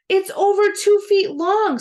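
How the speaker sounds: put-on high, loud screeching kid; a boy screeching that the worm is too long. A narrator's reading. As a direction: shriek it in disbelief. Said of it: "screeched Billy".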